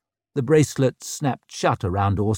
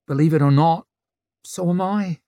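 Rika's rueful admission; 7 semitones higher, 4 dB louder than usual. A narrator's reading. Rika answers Orso, wincing.

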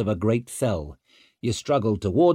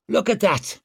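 excited; 8 semitones higher than usual. Acted. Stour excited.

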